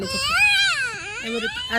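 high, slow pretend whine; mock crying. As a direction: fake a teary wail over the shirt. Mother mock-cries over stained shirt. (ఏడుపు నటన)

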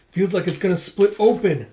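high, loud acted anguish; strained outburst as the head splits; agonized cry. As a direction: cry out in mock pain. Drugged man's head seems to split.

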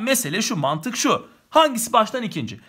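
usual speaking voice

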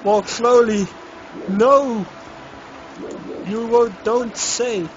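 loud, high urgent pleading; tense pleading. Real, not acted. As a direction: beg the character to behave. Pleading with game character.

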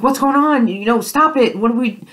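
Clark frantic as he suffers; panicked. Retold or imitated. Clark panicking at Bellamy's side, high frantic cry. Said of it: "and Clark's like".

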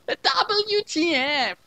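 high, slow, stretched-out shriek; mock scream. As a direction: shriek every letter as the shocked desk lady. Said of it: "screamed the desk lady".

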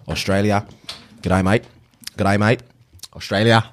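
warming into an Aussie accent; Australian accent. Shown as a demonstration. Trying to breathe in the Australian air, Australian accent. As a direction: practise short Australian greetings. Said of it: "all right, same thing".

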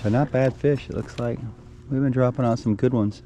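ordinary talk, nothing marked

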